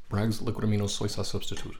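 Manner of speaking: fast ad read. Shown as a demonstration. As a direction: rattle it off quickly. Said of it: "can I do it faster, folks".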